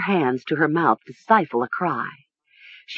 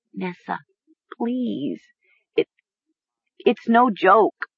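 stammering; 3 semitones higher, slower than usual. A narrator's reading. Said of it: "Mara stammered".

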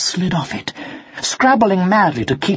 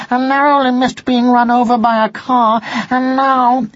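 higher, louder frightened kitten; breathless little cat pouring out his troubles; 8 semitones higher, 3 dB louder than usual. Acted. Kitten tells the fox his day.

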